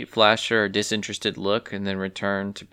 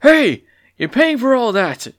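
loud, much higher furious shout; angry farmer yell; 14 semitones higher, 8 dB louder than usual. Acted. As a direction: yell after the clumsy hen. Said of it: "the farmer yelled out".

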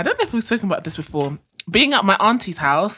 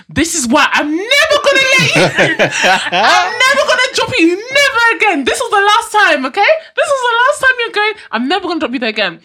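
higher, louder hammed-up scolding dad; mock angry dad shout; 14 semitones higher, 7 dB louder than usual. Dad telling off his crying daughter in the car, mock shouting. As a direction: bellow it like an exasperated father, half laughing.

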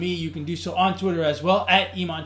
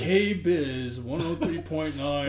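radio voice; 2 semitones lower, much slower than usual. Shown as a demonstration. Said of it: "your voice starts to change".

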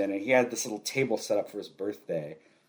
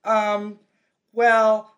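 dismissive brush-off; 10 semitones higher, 6 dB louder, slower than usual. Retold or imitated. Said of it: "she was just like".

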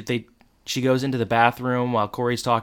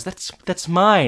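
high, louder, slow indignant cry; mock outraged protest; 7 semitones higher, 5 dB louder, slower than usual. Mary Beth sees her phone thrown out the window, high and indignant. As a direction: protest the loss in mock shock.

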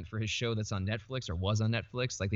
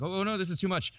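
higher-pitched mock fretting; mild retold panic; 9 semitones higher than usual. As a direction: replay a small flutter of panic. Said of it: "at first I kinda panicked".

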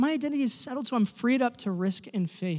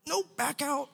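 high, softer cautioning aside; imitated warning; 7 semitones higher, 6 dB quieter, slower than usual. Listeners would warn him off, imitated high and small. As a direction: pipe it up high, as if backing away.

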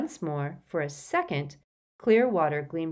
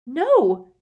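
high, loud cry; panicked exclamation; horrified denial; 10 semitones higher, 11 dB louder than usual. A narrator's reading. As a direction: cry out, refusing to believe. Harry shaking lifeless Dumbledore.

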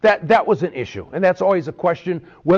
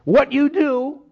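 put-on TV preacher accent; 7 semitones higher than usual. Pastor mocking a TV evangelist selling prayer rags, hammed-up evangelist accent. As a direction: lay on the televangelist accent, selling hard. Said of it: "they all talk in that type of accent".

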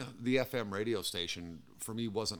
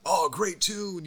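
higher, louder and slow gushing praise; a hammed-up delighted listener; mock excited listener; 9 semitones higher, 7 dB louder, much slower than usual. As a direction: gush like a thrilled fan. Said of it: "they're letting you know".

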